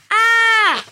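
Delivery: loud high scream; long high cry of someone falling. A narrator's reading. Falling boy screams in story.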